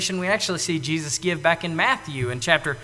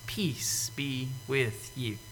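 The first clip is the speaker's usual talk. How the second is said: slow, hushed whisper; Jesus whispering peace to the disciples; soft whisper; 6 semitones lower, 12 dB quieter, much slower than usual. Retold or imitated. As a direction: breathe it out tenderly.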